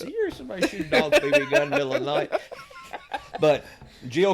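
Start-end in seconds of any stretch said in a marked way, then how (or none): 0.0-2.3 high, fast, laughing disbelief; fast amazed laughing question; incredulous. Retold or imitated. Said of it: "you ask".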